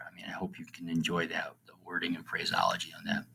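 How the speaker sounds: soft breathy aside to the class; hushed, fast aside; whispered aside. Real, not acted. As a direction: drop to a whisper to share the delight. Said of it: "whispered aside".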